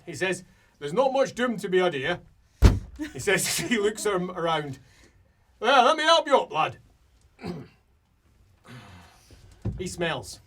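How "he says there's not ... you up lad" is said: high, loud dwarf voice; rally them as a no-nonsense dwarf; brisk dwarf. Acted. Dwarf helping a stricken lad up, loud and brisk.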